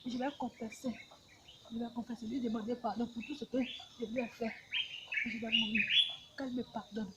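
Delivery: very soft, subdued confession; hushed confession. Real, not acted. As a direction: confess quietly, as one about to die. Confessing before death, asking pardon. (confession à voix très basse)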